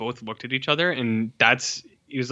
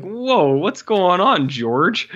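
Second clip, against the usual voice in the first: 1 shocked; 7 semitones higher, 3 dB louder, much slower than usual. Retold or imitated. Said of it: "You're like".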